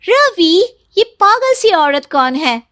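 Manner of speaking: sharp, affronted question; indignant surprise. Acted. Tina spots the other woman, indignant.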